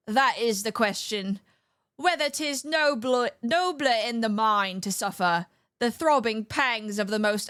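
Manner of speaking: hammed-up English stage accent for the soliloquy; British accent. Streamer parodying Hamlet in a put-on British accent. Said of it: "I gotta put the accent on".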